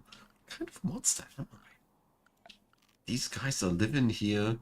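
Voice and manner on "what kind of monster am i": whispered, slow and much higher; slow theatrical whisper of shame; mock guilty whisper. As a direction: hush it like a confession of a terrible crime. Mock self-reproach over awful table.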